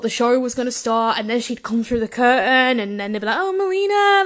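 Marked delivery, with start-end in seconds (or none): 3.4-4.2 high-pitched excited fan cry, not a full scream; mock fan squeal. Fan seeing wrestler come through the curtain, mock squeal. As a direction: call out like an adoring fan. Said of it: "I'd just scream my head off".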